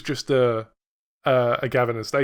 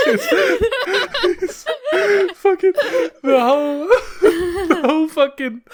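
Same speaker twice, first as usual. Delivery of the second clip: laughing hard; slower than usual. Real, not acted. Laughing hysterically at the scene.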